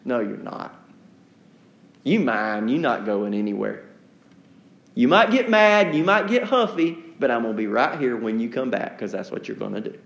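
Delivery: put-on a patient, unshaken reply to a sulking child; Jesus, gentle. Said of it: "he just looks back at you and goes".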